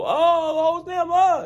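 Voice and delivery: high, loud mock wail; imitated outcry. Podcaster acting out what you hear in the audio drama, high mock outcry. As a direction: cry out high and drawn-out in imitation.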